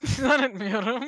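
slow, laughing echo of his words; laughing mimicry. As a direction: repeat it through laughter, drawn out. Friend echoes him while laughing.

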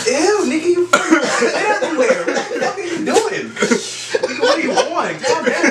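high-pitched laughing outburst. Real, not acted. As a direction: shriek it out through laughter. Friend freaking out mid-laugh.

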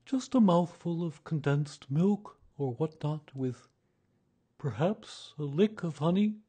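high bear voice, hinting wistfully; hint hopefully for a snack, high-pitched. A narrator's reading. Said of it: "he looked wistfully at the cupboard".

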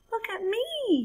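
high, bouncy bragging shout; braggy cry. Acted. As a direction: crow it like a proud little frog. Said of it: "he cried with a braggy bounce".

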